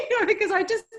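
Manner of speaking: high bursting laugh through words; giggling while explaining. Real, not acted. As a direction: laugh it out at yourself. Laughing at herself.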